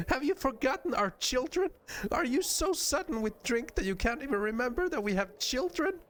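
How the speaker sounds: very high-pitched outraged wife; hammed-up indignation at a forgetful husband; rising mock fury. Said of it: "fury rises another notch".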